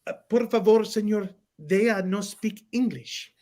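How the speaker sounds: lightly voiced nurse with a Spanish accent. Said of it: "And she goes".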